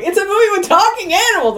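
mock quote. Mimics co-host's review reading.

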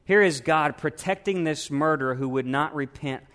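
usual speaking voice

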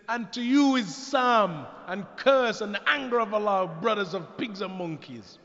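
loud, high-pitched acted outburst; indignant retort. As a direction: fire back a mock-furious curse. Translating Aisha's angry retort, acted out.

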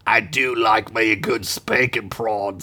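low goblin character voice; nasty goblin. A narrator's reading. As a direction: play a nasty, buck-wild goblin, pitched low. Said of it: "were three goblins, each as nasty and buck wild".

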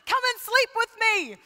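high, loud mock demand, hammed up; a hammed-up demand from Potiphar's wife; demanding seductress. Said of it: "she demanded".

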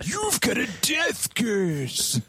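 high, slow eerie warning; slow ominous raspy drawl. Retold or imitated. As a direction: drag it out like a doomsayer. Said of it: "that line".

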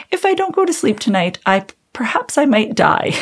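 high, tentative voice with a laugh behind it; half-laughing plea. Retold or imitated. Waking her husband to say she can't sleep, half-laughing high plea. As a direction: make a dire claim sound sheepishly polite.

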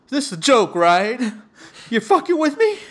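loud, high mock shock; stunned disbelief. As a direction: imitate a stunned sister, pitch jumping up. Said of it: "her eyes get fucking big".

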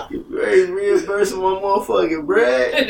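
indignant rant broken up by laughter; laughing mock outrage. Man told they only want to cuddle, laughing outrage. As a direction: protest in disbelief while cracking up.

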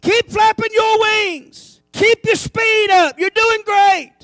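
cheering goose. Pretend. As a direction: cheer them on, drawn out. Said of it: "Keep honking".

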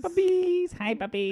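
put-on high squeaky baby talk to puppies. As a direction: coo at them like puppies.

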